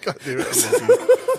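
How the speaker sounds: laughing hard while talking. Real, not acted. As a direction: laugh through the words.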